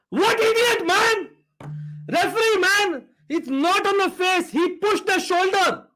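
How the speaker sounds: high-pitched, exasperated outburst at the referee; outraged protest. Real, not acted. Angry protest at referee's red card.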